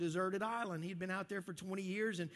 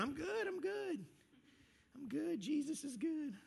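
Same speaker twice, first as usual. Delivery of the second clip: high, forced chirpy reassurance in a Texas twang; fake cheerful; 8 semitones higher than usual. Churchgoer dodging a friend's question, high and forced cheery. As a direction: brush off the question with a bright high I'm fine. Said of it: "we look at each other and we go".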